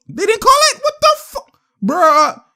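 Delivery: high-pitched, incredulous protest at the refs; can't believe that call; outraged disbelief. Real, not acted. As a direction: voice shoots up in disbelief. Disputes a missed foul call.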